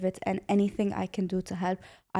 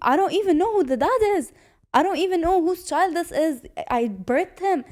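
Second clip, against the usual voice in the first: higher, louder voicing of a distraught mother; impassioned imitation of a stunned mother; retold distress; 9 semitones higher, 5 dB louder, much faster than usual. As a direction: quote her disbelief in a raised, rushed voice. Retelling prisoners' anguished testimony.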